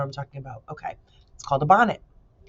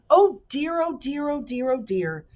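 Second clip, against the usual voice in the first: nervous fretting; 8 semitones higher than usual. A narrator's reading. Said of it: "pace nervously".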